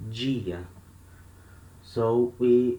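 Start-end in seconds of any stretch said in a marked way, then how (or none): 0.1-0.6 "dia" opening with an English j sound; Rio pronunciation. Shown as a demonstration. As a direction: soften the d of "dia" into "dj". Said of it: "we say like this".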